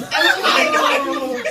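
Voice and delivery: bursting into loud high laughter; loud laughing. Real, not acted. Laughs at the absurd accusation.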